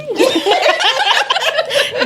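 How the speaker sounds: big high laugh; burst of laughter. Real, not acted. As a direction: laugh out loud. Joke about diagonizing.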